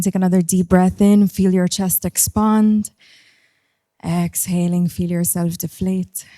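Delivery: guided breathing. Acted. Leads a breathing exercise.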